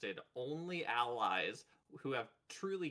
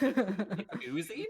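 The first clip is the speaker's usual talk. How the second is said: laughing; 7 semitones higher, 9 dB louder, much slower than usual. Real, not acted.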